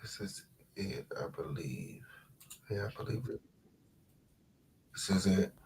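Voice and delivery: hushed whisper to herself. Real, not acted. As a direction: whisper it as you find it. Finding the clip, whispering.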